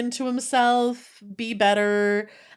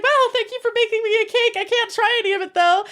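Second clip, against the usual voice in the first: higher, fast, nervously polite fangirl; high polite refusal; 11 semitones higher, 3 dB louder, faster than usual. Retold or imitated. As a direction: decline sweetly but firmly. Girl politely refusing idol's cake.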